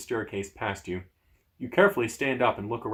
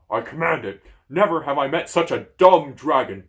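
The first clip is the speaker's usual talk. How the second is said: loud, gruff giant voice scolding; angry giant; 3 semitones higher, 7 dB louder than usual. Acted. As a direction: rage at the useless dragon. Giant yelling at dragon.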